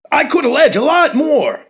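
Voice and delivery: high, loud taunting claim; loud defiant boast. Acted. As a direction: dangle the threat. Candidate hinting darkly.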